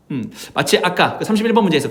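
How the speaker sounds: normal talking voice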